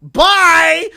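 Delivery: loud high mocking goodbye; gloating shout. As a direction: shout it after him with glee. Gloating farewell.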